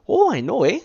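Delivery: loud, high-pitched Canadian imitation; mock Canadian accent. Mimicking his Canadian girlfriend's crowd, hammed-up Canadian accent. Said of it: "was dating the Canadian chick".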